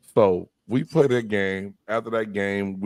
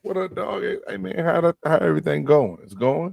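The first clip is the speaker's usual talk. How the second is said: lightly acted higher-pitched buddy greeting; put-on friendly higher voice; casual check-in; 7 semitones higher than usual. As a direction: feign a casual hello to a teammate.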